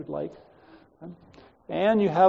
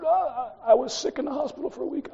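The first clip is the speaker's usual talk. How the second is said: high-pitched, stammering plea; flustered excuse; 8 semitones higher than usual. Retold or imitated. Student makes excuses to the angry chairman, high flustered stammer. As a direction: play a cornered student squirming out of trouble.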